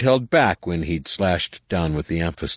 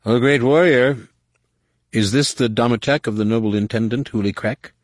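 lightly voiced supplicant; 5 semitones higher than usual. A narrator's reading. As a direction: address a warrior with exaggerated deference. Fake humble worker addressing a warrior.

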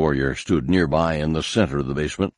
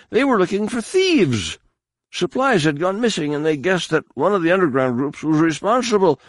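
frantic, much higher, pleading; 12 semitones higher than usual. A narrator's reading. Frantic captive explaining.

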